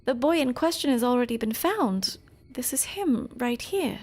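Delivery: lightly voiced carer. Another character voiced in game.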